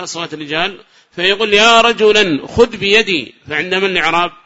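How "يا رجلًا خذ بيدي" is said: slow, raised, louder call for guidance; blind man calling. Acted. Blind man hears men's voices, calls out slowly. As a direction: call out drawn-out to whoever is near. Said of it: "فيقول مثلًا وهو يريد القيادة".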